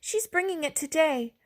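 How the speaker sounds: put-on high, eager child's voice; little girl stopping the dinosaur, high voiced; little girl's plea.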